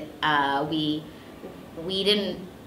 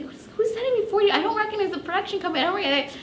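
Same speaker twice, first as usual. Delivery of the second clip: confused, fast; much faster than usual. Retold or imitated. Puzzled by a surprise check.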